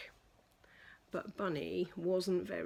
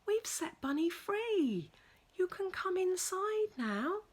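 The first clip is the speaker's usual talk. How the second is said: gentle reassurance; 11 semitones higher than usual. Acted. Reassuring cat, whispered.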